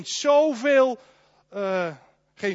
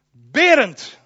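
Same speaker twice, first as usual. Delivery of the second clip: calling out; 6 semitones higher, 10 dB louder than usual. Pretend. Calls a congregant by name.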